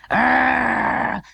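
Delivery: rough mock dog bark. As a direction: bark like a frantic little dog. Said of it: "came the sound".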